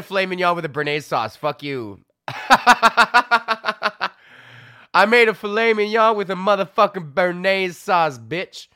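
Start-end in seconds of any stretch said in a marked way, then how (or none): 2.3-4.1 high cackling laugh; loud laugh. Real, not acted. Laughs after cheeky boast. 4.9-8.7 louder, proud brag; triumphant boast. Real, not acted. Triumphant boast after laughing.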